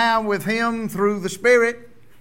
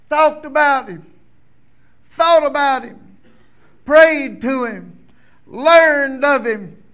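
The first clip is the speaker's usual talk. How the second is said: louder and higher, slow and hammered; building preaching litany; 5 semitones higher, 8 dB louder, slower than usual. Real, not acted. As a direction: build each phrase toward the climax.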